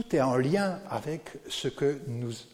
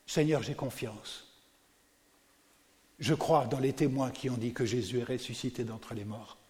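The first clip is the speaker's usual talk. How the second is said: soft, hushed confession of faith; fading to a near-soft devotional murmur; quiet prayer; 2 semitones lower, 3 dB quieter than usual. Acted. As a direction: pray it softly for the congregation.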